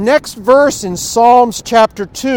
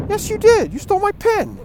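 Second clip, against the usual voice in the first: much higher, insistent accusing cry; retold accusation; 13 semitones higher than usual. Big American on the bus accusing the thief, high insistent cry. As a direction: push back hard at the pickpocket, pitch way up.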